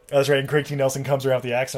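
ordinary talk

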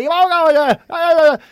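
high, loud, drawn-out mock yelp. Garda stopping a biker from leaving, yelping. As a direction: yelp it in garbled outrage. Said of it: "Starts yelping".

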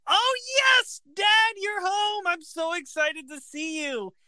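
high, gushing excited voice, slowed on Dad; mock-gleeful high-pitched greeting; squealing son overjoyed to see his father. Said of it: "he's like".

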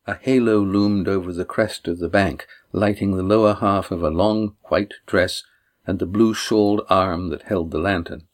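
usual speaking voice

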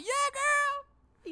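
very high, loud sassy cheer; Tanya cheering. Retold or imitated. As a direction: hype up your friend. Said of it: "She's like".

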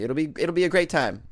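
ordinary delivery

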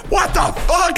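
very high, squealed mock outrage; imitated outraged wife. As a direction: mimic a wife's disbelief with pitch shooting right up. Wife's outraged reaction, voiced in story.

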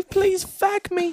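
high, slow falsetto begging; mock falsetto plea. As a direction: beg in a silly high falsetto. Mocking heroine's plea.

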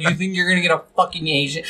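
scornful, fast, offended snap; mean offended retort. Retold or imitated. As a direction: bite back at him, insulted. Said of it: "She's kinda mean about it".

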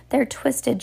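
high, mild shriek. A narrator's reading. Colette accuses from the crowd, mild high shriek. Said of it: "shrieked Colette".

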